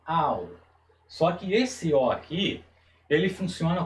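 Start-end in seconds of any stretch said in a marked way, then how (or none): 0.0-0.5 "Au" with A stressed and O nearly silent; kamatz sound. Shown as a demonstration. Teacher repeats the vowel, A stressed. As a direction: pull the sound into "Au". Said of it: "ele é puxado mais dessa forma".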